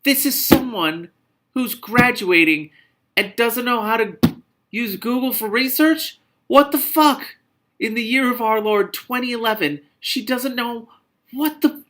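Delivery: incredulous rant. Real, not acted. Ranting at the book's heroine.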